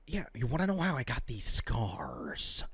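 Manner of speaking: low, hushed menacing whisper; soft, sinister near-whisper; Joker impression. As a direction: whisper it like a creepy villain. Acts out the Joker's line.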